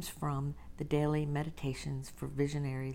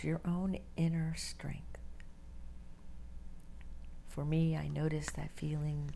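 guided meditation; 4 dB quieter than usual. Acted. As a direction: ease them into stillness, breathy and unhurried.

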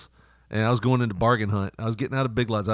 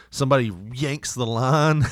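ordinary talk, nothing marked

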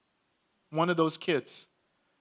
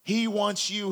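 ordinary talk, nothing marked

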